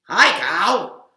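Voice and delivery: loud pig greeting; loud cheery pig hello. Acted. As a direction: call out as Pig.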